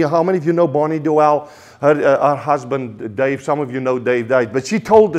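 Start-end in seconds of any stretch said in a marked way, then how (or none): none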